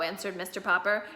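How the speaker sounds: ordinary delivery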